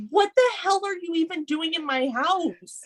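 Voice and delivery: high-pitched mock outrage at an intruder; incredulous outrage. Podcaster voicing a movie wife put on the spot, incredulous. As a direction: act out flustered, high-voiced disbelief. Acting out a cornered character's outrage.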